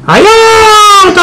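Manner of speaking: high squealing help; mock cry for help. Child cries for help.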